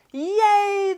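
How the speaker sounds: a high, loud cheer; cheering crowd. A narrator's reading. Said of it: "the crowd cheered".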